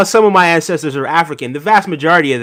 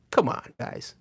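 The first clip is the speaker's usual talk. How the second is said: softer, breathy whisper of the plea; 5 semitones lower, 15 dB quieter, slower than usual. Real, not acted. Urging his viewers again, now in a whisper. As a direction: breathe it out under your voice.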